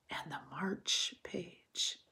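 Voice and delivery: hushed, slow whisper; quiet close while Toad sleeps. A narrator's reading. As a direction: trail off in a whisper. Quiet end of story.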